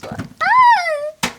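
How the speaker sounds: high shriek of fright; startled scream. Acted. Climbing, spider scare.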